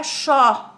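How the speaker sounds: "xó" with an open o, drawn out. Shown as a demonstration. Teacher showing how an acute would sound, open ó in "xó". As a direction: open the vowel wide on "xó", as with an acute accent. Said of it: "tivesse o agudo".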